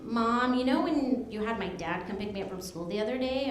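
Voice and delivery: low, soft imitation of a hesitant daughter; hushed confiding. Teenage daughter raising something with mom, hushed and confiding. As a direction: bring it up quietly, well under normal volume.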